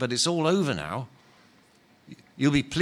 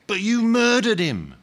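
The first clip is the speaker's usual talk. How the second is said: higher, louder outraged charge; indignant accusation; 9 semitones higher, 5 dB louder than usual. Acted. Big ghost accusing Len of murder, outraged. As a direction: throw the murder back at him.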